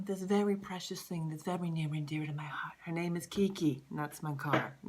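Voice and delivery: whisper. Acted. Confiding about her beloved car.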